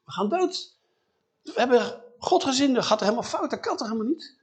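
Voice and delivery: a husband sure they'll die, voice shooting up; high-pitched mock panic; panicking. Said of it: "gaat hij volledig van het padje af".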